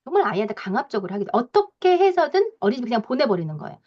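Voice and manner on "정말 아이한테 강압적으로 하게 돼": normal talking voice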